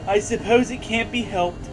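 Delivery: ordinary delivery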